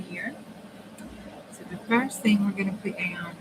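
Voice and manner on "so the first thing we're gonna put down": usual speaking voice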